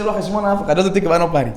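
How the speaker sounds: ordinary talk, nothing marked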